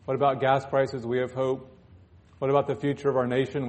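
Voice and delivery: ordinary delivery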